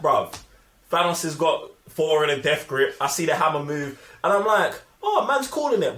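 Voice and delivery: normal talking voice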